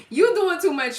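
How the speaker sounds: loud, high laughing scold at normal pace; laughing again. Real, not acted.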